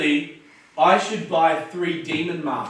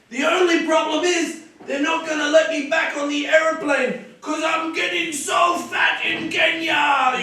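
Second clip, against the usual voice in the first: joking crowd shout; 10 semitones higher than usual. Shown as a demonstration. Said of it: "and then I'll say".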